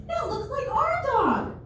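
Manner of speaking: high, slow squeal of recognition; excited shriek. Retold or imitated. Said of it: "looks at me and goes".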